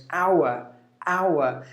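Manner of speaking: "hour" as OW-uh, no R; British non-rhotic "hour", OW-uh; British pronunciation. Shown as a demonstration. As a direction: say "hour" with a soft uh ending. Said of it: "That's British English".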